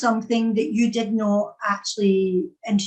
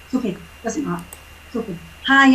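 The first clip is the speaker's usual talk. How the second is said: normal talking voice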